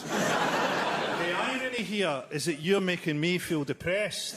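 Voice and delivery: slow, dry deadpan reply; dry slow put-down; deadpan comeback. Retold or imitated. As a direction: drag it out, utterly unimpressed. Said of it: "I said".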